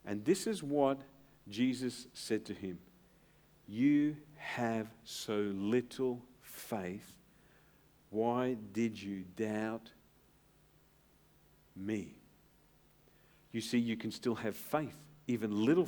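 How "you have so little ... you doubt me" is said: slow, soft, lower rebuke of a friend; gentle rebuke. Retold or imitated. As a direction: chide him tenderly and slowly. Jesus rebuking Peter gently.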